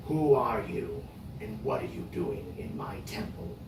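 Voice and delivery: deep, hollow voice from everywhere; booming temple spirit voice; unearthly voice. Acted. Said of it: "you hear this voice, this disembodied".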